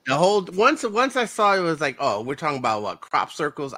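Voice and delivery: high, loud scoff at the premise; mock the idea of crop circles; 1 scoffing disbelief. Real, not acted. Scoffing at the film.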